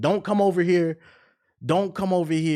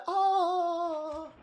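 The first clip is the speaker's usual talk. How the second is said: long, high, softer mock wail; imitated cry; 12 semitones higher, 6 dB quieter than usual. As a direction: draw out a high "oh" like a stricken villain. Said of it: "She said".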